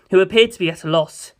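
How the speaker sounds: ordinary delivery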